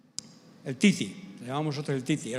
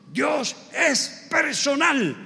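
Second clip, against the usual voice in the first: solemn pastor; 9 semitones higher, much slower than usual. Retold or imitated. Pastor's solemn verdict retold.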